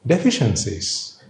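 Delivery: usual speaking voice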